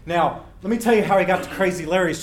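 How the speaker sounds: ordinary delivery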